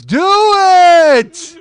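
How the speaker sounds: drawn-out, high-pitched mock crowd roar; crowd shout. Audience egging on the comic to post it, shouting. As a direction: imitate a whole crowd yelling, high and loud. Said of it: "everybody in the crowd goes".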